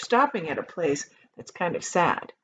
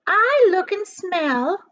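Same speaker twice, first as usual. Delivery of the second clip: put-on high, loud, vain old aunt; shrill-voiced boastful aunt; Aunt Sponge's voice; 10 semitones higher, 7 dB louder, slower than usual. A narrator's reading. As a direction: preen like a conceited hag. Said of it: "Aunt Sponge declared".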